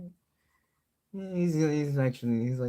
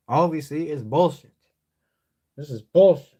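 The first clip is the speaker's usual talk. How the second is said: loud exasperated outburst; loud rant; 3 semitones higher, 9 dB louder than usual. Acted. Vlogger ranting to viewers, loud exasperated shout. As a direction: shout it out, fed up with the boring content.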